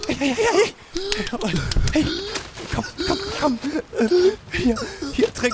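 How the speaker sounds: high, hurried coaxing; urgent. Acted. Doctor urgently tending the dying enemy soldier, frantic. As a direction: rush to help him drink.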